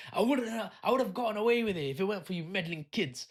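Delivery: high-pitched thwarted-villain grumble; hammed-up foiled cartoon villain. Unmasked Scooby-Doo villain blaming meddling kids, hammed-up outrage. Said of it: "It's a Scooby-Doo reference".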